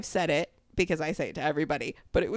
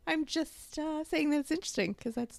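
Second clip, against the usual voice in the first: much higher, prim boss voice; imitating her boss; 10 semitones higher than usual. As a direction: mimic the boss pretending not to judge. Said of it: "she's talking like this".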